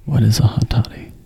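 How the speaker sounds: breathy whispered question; whispering. Real, not acted. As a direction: ask it in a hush. Asks whispered aside.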